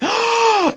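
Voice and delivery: loud shocked intake of breath, high; sharp high gasp. Retold or imitated. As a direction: gasp like the news floored you. Aunt loses it.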